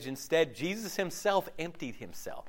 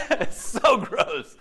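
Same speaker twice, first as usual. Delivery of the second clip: says it again laughing, slower and louder; laughing repeat; 5 semitones higher, 5 dB louder, slower than usual. Real, not acted. Preacher laughing at Murphy's remark, slower and louder. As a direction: laugh at the joke and give it more punch.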